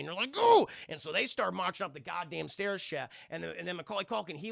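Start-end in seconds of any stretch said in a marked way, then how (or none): 0.4-0.7 loud, high rising "ooh"; enraged howl. Retold or imitated. Burglars taunted by the kid, enraged high howl. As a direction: imitate burglars boiling over.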